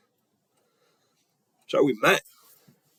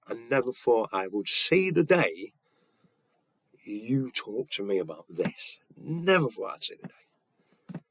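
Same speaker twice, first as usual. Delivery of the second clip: moved, emotional; 4 dB quieter, slower than usual. Retold or imitated. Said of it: "we were both emotional".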